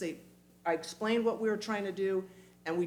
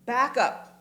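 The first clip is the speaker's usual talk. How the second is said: firm halt; 6 semitones higher, 7 dB louder than usual. Shown as a demonstration. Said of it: "just go".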